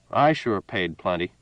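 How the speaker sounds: plain reading voice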